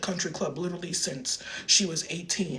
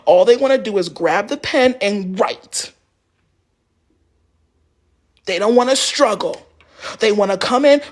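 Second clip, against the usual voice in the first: passive aggressive lecture; 4 semitones higher, 10 dB louder than usual. Retold or imitated. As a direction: redo it the way she really said it, sugary and scolding. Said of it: "This is how she wanted it".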